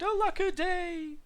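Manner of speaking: high, drawn-out lament; plaintive little dinosaur; crying out. A narrator's reading. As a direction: wail it slowly, far up in pitch. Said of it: "he cried".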